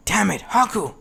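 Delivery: sharp angry shout. Acted. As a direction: yell the name out. Villain shouts at fallen ally.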